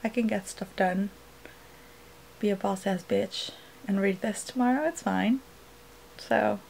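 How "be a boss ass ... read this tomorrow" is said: ordinary talk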